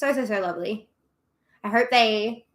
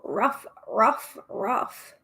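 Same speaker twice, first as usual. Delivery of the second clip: playful dog bark; 4 dB quieter, much slower than usual. Pretend. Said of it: "I'm perhaps a furry".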